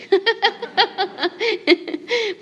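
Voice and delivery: a high, bubbling real laugh; amused high-pitched giggle. Real, not acted. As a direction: giggle at your own joke. Laughs at the British-sounding translation.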